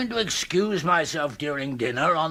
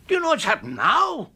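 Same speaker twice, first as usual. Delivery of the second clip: high, louder exasperated demand; agitated outburst; 13 semitones higher, 4 dB louder than usual. Acted. Uncle bursting in, agitated about the theft. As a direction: burst out in alarm.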